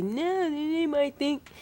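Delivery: female voice. Retold or imitated. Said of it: "a girl with a deep voice".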